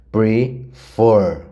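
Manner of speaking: "Prefer" com o peso no "fer" final; tônica no fer. Shown as a demonstration. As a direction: bata forte no "fer" de "Prefer". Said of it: "O for é mais forte". (professora acentuando o "fer" de "Prefer")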